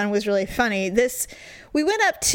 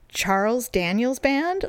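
retold puzzled query; slower than usual. He queries her wrong band name after dead silence, puzzled. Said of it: "there was all this dead silence".

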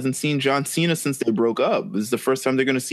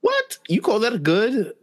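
incredulous; 9 semitones higher, slower than usual. Real, not acted. Mocking disbelief at friend.

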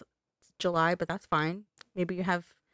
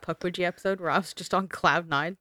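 ordinary delivery

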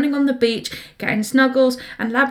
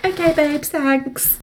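sarcastic thanks; 5 semitones higher, slower than usual. Retold or imitated. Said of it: "you're like".

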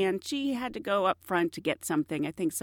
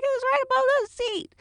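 high sweet singsong. Retold or imitated. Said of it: "I'm like".

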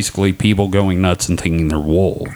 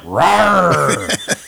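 mock roar; 8 semitones higher than usual. Voicing whale picture roaring.

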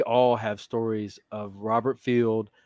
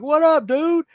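high, loud hail across the water; calling out; 6 dB louder, slower than usual. Retold or imitated. Friend pulling up by boat, calling out a loud high hello. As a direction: shout a greeting to a buddy in a passing boat.

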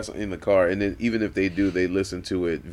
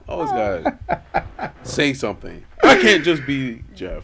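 laughing fake whine; mock whine; 7 semitones higher, 6 dB louder, slower than usual.

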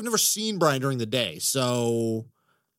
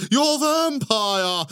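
playful; 5 semitones higher, much slower than usual. Real, not acted. Playful sing-song emphasis.

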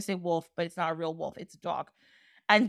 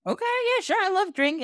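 much higher, delighted voice; eager yes; 10 semitones higher than usual. Acted. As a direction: jump at the offer, pitch flying up. Rip gladly accepts a drink.

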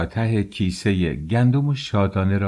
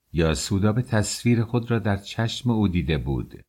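ordinary delivery, nothing marked

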